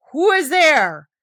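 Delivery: loud demand to an intruder; thundering. A narrator's reading. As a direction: thunder it so it echoes through the hall. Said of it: "thundered the doctor in a voice that echoed".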